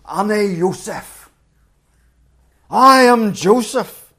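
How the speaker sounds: a man overcome by tears revealing himself; sobbing. A narrator's reading. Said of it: "breaks down sobbing".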